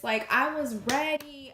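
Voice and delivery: emphatic shout. Real, not acted. Telling viewers how ready she was, a slow loud declaration. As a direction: slam the point home. Peak of retold hype.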